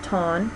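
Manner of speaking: torn said ton, R dropped, long open o. Shown as a demonstration. Teacher showing non-rhotic ton. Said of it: "torn is pronounced ton".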